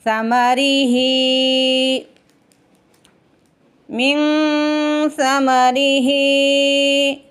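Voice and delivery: समरिही with a soft sa, no whistle, and a drawn-out ही; soft tha, long ha. Shown as a demonstration. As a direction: say समरिही gently, no hiss on सा, stretch ही. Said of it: "सा को नरमी से अदा करेंगे". (समरिही में सा नरमी से, हा खींच कर)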